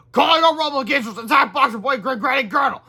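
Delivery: high, loud commanding call; villain command. Acted. Villain calling robots to attack heroes, loud command.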